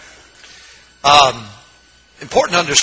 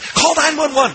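high-pitched imitation of crying panic; mock tearful panic; 12 semitones higher than usual. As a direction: act out a frantic plea for help. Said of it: "and he's crying".

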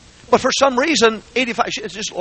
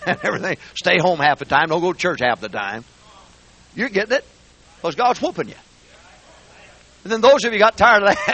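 normal talking voice

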